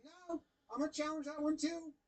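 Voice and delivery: an excited batter insisting on a challenge; insistent batter. Retold or imitated. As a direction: demand it like a batter who won't back down.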